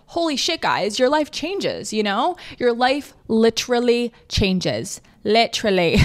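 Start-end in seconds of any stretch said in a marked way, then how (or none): 2.6-6.0 put-on slow Australian accent on the big claim; drawn-out mock Australian accent. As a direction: slip into a playful Australian accent. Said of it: "talking in Australian accents".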